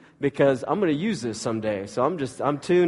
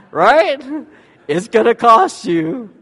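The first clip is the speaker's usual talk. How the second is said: much louder and higher, slow and drawn out; punchline emphasis; 10 semitones higher, 11 dB louder, slower than usual. Shown as a demonstration. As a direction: hammer home the punchline. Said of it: "punchline repeated".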